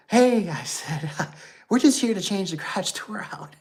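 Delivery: high, loud, laughing nervous call; nervous placating. Retold or imitated. Worker talking to a man with a shotgun, nervously high.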